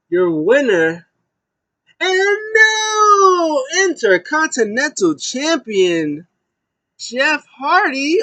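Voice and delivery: very high, loud, stretched-out champion announcement; ring announcer. Acted. Announcing Jeff Hardy as new champion, a big drawn-out announcer call. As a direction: belt it out slowly like a ring announcer.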